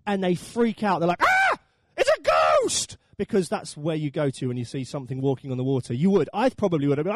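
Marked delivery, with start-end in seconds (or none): 1.2-3.0 high, loud mock scream of fright; hammed-up terrified shout; panicked cry. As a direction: shriek it like you've seen a ghost. Disciples freak out seeing Jesus on water.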